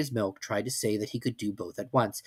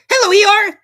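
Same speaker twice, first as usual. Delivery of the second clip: high little squeak; tiny excited squeaky voice; squeaky Roo; 15 dB louder than usual. A narrator's reading. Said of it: "said Roo".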